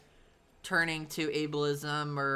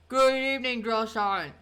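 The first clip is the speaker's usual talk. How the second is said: higher, louder imitation of a quirky recruit; odd trainee voice; 6 semitones higher, 5 dB louder than usual. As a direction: mimic the strange way he spoke. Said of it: "he actually talked like that".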